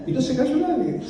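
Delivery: high, thin comic voice for the pastor's punchline; imitating the old pastor. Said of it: "dice el hermano Casana". (imitando al pastor con voz aguda y cómica)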